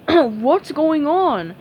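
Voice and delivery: drowsy, slow, dim-witted drawl; slow dopey starfish voice. Acted. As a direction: sound half-asleep and slow. Sleepy Patrick waking.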